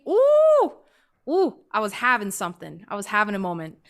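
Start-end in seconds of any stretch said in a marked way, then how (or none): 0.1-0.7 very high, loud "ooh"; swooning ooh. Retold or imitated. Telling how the handsome man smiled, swooning. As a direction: squeal with smitten delight.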